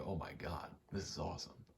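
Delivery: ordinary talk, nothing marked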